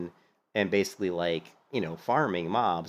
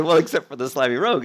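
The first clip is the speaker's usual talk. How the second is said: gleeful repeat of the jab; 5 semitones higher, 5 dB louder than usual. Retold or imitated. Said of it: "I said".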